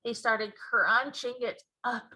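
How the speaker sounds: usual speaking voice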